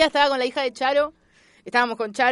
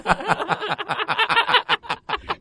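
laughter; 7 semitones higher than usual. Real, not acted. Laughs at cake story.

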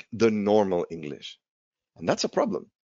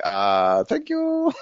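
baffled learner; 11 semitones higher, much slower than usual. Acted. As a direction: mimic a lost student's hollow polite thanks. Confused learner's polite reply acted out.

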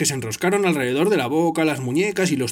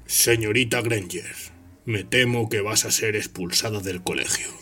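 icy, contemptuous teacher; Snape's voice; 7 semitones lower than usual. Acted. Snape scolds Hermione.

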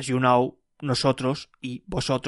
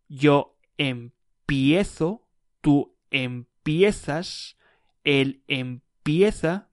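empiezo, empiezas, empieza spelled out very slowly; slow drill; much slower than usual. Shown as a demonstration. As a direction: drill each form slowly for the learner. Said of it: "it will be something like".

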